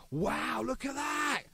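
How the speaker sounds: light imitation of a friend wowed by rare vinyl; retelling a friend's excited wow; impressed exclamation. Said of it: "and he went".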